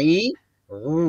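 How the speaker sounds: ই, উ each said apart with a thick, heavy voice; heavy, thick sound. Shown as a demonstration. Teacher contrasting the second letter for students, heavy sound. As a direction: give ই and উ a dense, heavy sound. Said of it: "দ্বিতীয়টা গাঢ় আওয়াজ".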